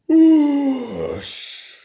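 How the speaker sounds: loud high whoosh; loud hissing whoosh. A narrator's reading. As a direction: blast the whoosh out. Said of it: "he hissed".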